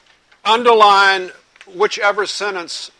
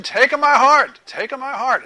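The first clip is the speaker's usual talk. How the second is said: higher, louder eager crowd chant; crowd shouting; 7 semitones higher, 5 dB louder, slower than usual. Retold or imitated. Crowd begging for the feather to land on them, shouted chant. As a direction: call out to be picked. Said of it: "everybody's shouting".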